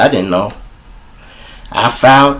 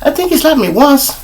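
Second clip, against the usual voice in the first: woman recalling. Retold or imitated. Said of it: "ask any woman I ever fuck with".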